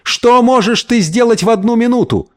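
high-pitched imploring question; pleading shout. A narrator's reading. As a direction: call after him, begging him to stop. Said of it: "умоляюще крикнул вдогонку Гомес". (умоляющий крик вдогонку)

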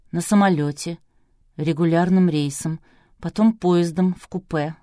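ordinary delivery

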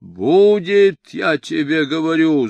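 high, loud argumentative insistence; overbearing drunken arguer; insistent. A narrator's reading.

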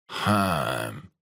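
low, thoughtful hum; drawn-out low "hm"; pondering. A narrator's reading. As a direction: mull it over as an old man. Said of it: "задумался старик".

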